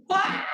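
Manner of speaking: high, loud sudden squeal of delight; a yelp of happy surprise; excited squeal. Real, not acted. Painter spotting a new sale, excited squeal.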